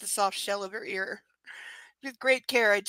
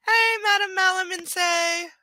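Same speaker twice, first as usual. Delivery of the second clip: much higher, slow, hammed-up sing-song greeting; silly high voice; 10 semitones higher, 9 dB louder, slower than usual. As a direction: stretch it out in a goofy high voice.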